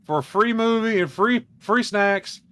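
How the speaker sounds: lightly voiced manager; manager caving. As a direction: give in to the angry crowd. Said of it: "So, like".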